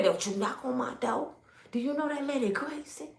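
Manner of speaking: put-on a hushed, fearful old man; hushed and nervous; scared old man. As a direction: whisper a warning through the door.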